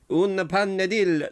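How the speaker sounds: hammed-up Spanish accent on "Una panadilla"; Spanish twang. Host retries the Spanish title in a mock Spanish accent. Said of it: "Should use a Spanish twang".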